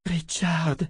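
high, tender call; on the verge of tears. A narrator's reading. Shota turns to Richard, on the verge of tears. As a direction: say the name as if holding back tears. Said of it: "dit Shota au bord des larmes".

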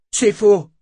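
loud, high angry shout; cri de colère. A narrator's reading. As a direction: shout the denial furiously. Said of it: "cria Fitch, les poings serrés". (démenti crié, fort et furieux)

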